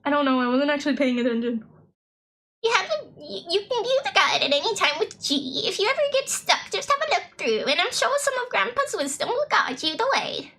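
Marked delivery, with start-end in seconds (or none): 2.6-10.5 high, swooping British granny voice; British grandma. Acted. Grandma fussing over her returning grandchild, high British granny voice. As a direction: play a fussing British grandmother, pitched well up. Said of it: "why the grandma is British".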